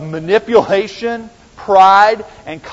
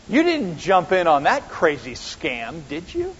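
belittling. Acted. Someone purposely manipulating you, belittling. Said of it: "Belittling, isn't it?".

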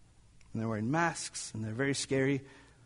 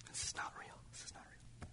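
voiceless, barely audible whisper; 19 dB quieter, faster than usual. Acted. Father telling himself it's not real, whispering. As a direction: whisper it to yourself, almost gone.

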